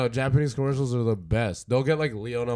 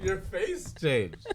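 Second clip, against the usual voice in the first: laughing; 7 semitones higher, 6 dB quieter, much slower than usual. Real, not acted. Laughing remark.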